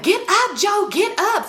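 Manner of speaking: high, insistent mock yell; urgent retold order. Woman pulling her man by his shirt, urgent order. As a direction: order him up, pitch leaping.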